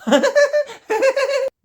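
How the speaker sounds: high-pitched put-on cackle; mock auntie cackle. Aunties laughing at the new bride, high mocking cackle.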